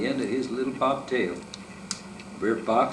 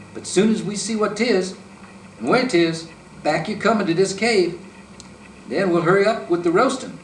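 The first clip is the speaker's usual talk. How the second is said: higher and louder, bossy terms; threatening fox; 7 semitones higher, 4 dB louder than usual. A narrator's reading. Fox to the rabbit, a loud threat. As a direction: lay down the terms firmly.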